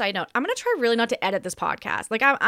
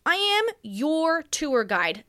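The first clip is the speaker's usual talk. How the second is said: "I am your tour guide" drawn out high and slow; slow grand intro; 7 semitones higher, much slower than usual. Acted. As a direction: announce yourself like a flight attendant. Repeats her playful host intro.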